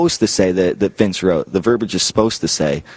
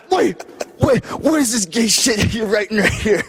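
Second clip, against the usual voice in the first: much higher, shocked shrieking voice; outraged Vince; 12 semitones higher than usual. Retold or imitated. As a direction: squeal in disbelief at the script. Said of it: "And then he goes".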